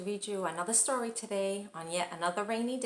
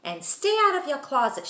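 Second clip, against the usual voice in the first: loud, higher parting command; parting order; 6 semitones higher, 7 dB louder than usual. Acted. Mother ordering her son as she closes the door, loud. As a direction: bark it as the door shuts.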